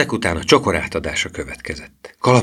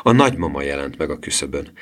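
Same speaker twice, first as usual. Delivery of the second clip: plain reading voice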